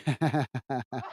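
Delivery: soft, low chuckle; laugh. Real, not acted. Streamer amused by a viewer's chat message, soft laugh. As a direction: laugh quietly after reading out the message.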